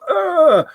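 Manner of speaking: sudden high yelp of alarm; a short, sharp "Ah!" as if the horse moves under you; startled cry. Retold or imitated. Startled cry while mounting horse.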